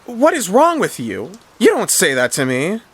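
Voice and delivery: high-pitched indignant protest; acted taken-aback scolding; offended. A narrator's reading. T D objects to being cursed.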